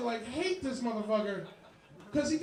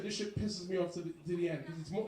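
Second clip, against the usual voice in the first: usual speaking voice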